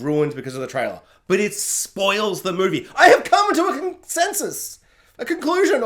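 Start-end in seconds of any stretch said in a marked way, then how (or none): 3.0-5.8 high-pitched, grand announcement; hammed-up pronouncement; mock proclamation. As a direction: declare it like a great verdict.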